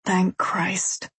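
whispering. Acted. Relieved aside after tense talk.

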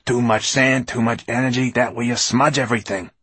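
high, loud scolding; snapping. A narrator's reading. Writer scolds glass man over sand, snapping. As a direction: fuss crossly over every mistake. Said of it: "snapped Fenoglio".

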